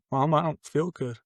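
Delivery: put-on high, small voice of a frightened little boy; scared child voice. Son to his mom after a drug binge, small scared child voice. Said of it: "with the most child voice, shaking and scared".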